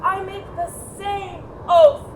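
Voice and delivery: mother acting out the rally speech; rousing oratory. Shown as a demonstration. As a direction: whip the crowd to a sworn oath.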